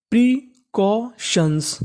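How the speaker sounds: Precautions drawn out very slowly, syllable by syllable; very slow sounding out. Shown as a demonstration. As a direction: spell out precautions slowly as a teacher. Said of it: "तो पूरी meaning बन गई". (Precautions बहुत धीरे, अक्षर-अक्षर)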